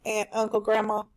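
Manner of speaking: usual speaking voice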